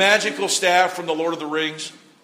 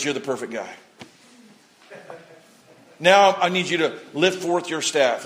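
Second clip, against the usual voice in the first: voice of God; 7 semitones lower, 5 dB quieter than usual. Retold or imitated. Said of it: "And God says".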